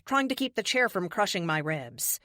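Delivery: ordinary delivery, nothing marked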